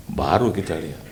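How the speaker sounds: ordinary talk, nothing marked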